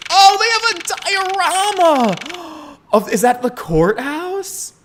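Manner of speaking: very high, drawn-out squeal of delight; thrilled, stretched-out gasp of wonder; excited squeal. Real, not acted. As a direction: gush slowly in a real high pitch. Delighted at seeing a diorama.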